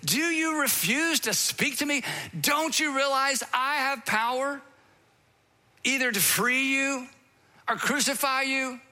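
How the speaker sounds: lightly voiced governor losing patience; exasperated Pilate. Pilate demands an answer from silent Jesus, exasperated. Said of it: "he's exasperated".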